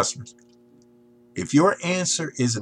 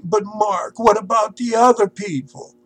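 high, sing-song mock whine; mock the fussy perfectionist in a high sing-song; high sing-song voice of a fretting perfectionist; whiny perfectionist; 9 semitones higher, 5 dB louder than usual. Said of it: "and your mind is turning".

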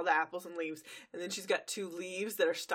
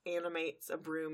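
ordinary talk

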